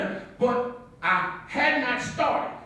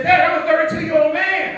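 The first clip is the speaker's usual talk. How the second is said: loud, higher, indignant grown son; indignant protest; 6 semitones higher, 9 dB louder than usual. Retold or imitated. Grown son protesting loudly to his father.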